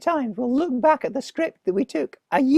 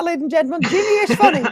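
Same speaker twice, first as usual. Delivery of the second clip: mock announcer; 2 semitones higher, 5 dB louder, faster than usual. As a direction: proclaim it to the crowd.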